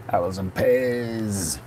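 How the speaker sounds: high, drawn-out Cockney street cry; playful Cockney. Pretend. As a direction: stretch out the last word in hammed-up Cockney. Playful Cockney rhyming-slang outburst.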